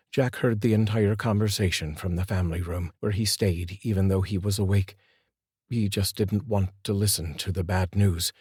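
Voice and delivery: ordinary delivery, nothing marked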